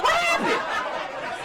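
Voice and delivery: very high, stretched-out question; mock innocent surprise. Host feigning innocence about bedbug bites, high mock surprise. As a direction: play dumb in a high squeak.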